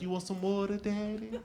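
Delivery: a hint of a soft, sultry nurse; flirty nurse voice. Nurse offers comic water, lightly voiced sultry coo. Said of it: "that's how she said it".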